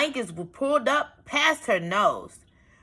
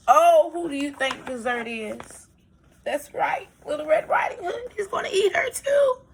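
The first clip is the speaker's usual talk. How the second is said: playful suspense; 10 semitones higher than usual. Real, not acted. Asking children in suspense.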